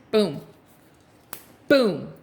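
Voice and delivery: punchy, falling booms, then drawn-out verdict; blunt mic drop. Acted. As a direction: drop the message like a gavel. Acting out a blunt message delivery.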